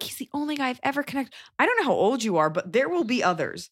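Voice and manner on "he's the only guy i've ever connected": mocking smitten girl. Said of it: "girls are like".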